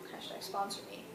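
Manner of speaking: quick whisper under the breath; whispered aside. Real, not acted. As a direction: toss it off as a hushed joke. Joking aside about sponsorship.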